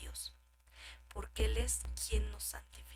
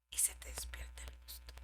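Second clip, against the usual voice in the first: warning whisper; 12 dB quieter than usual. Acted.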